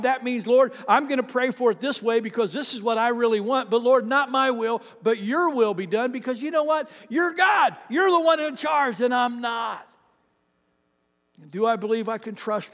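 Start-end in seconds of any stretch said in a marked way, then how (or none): 0.0-9.9 loud, high preacher building to a shout; full-force preaching. Real, not acted. Preacher praying aloud to the Lord, forceful and building. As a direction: preach it out with rising conviction.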